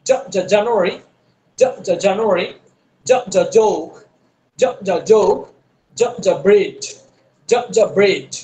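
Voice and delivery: জ, জ, জানুয়ারি with a full dj; dj sound. Shown as a demonstration. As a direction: voice the dj from the back of the tongue. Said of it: "জ, জিহ্বার গোড়া থেকে".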